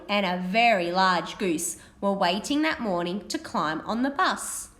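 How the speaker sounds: animated picture-book reading; reading to children. Acted. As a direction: read it brightly to a class of children. Teacher reads picture book aloud.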